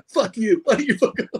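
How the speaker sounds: playful swearing through a laugh; laughing retort. Retold or imitated. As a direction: fake outrage that cracks up. Buddy's joking retort, laughing.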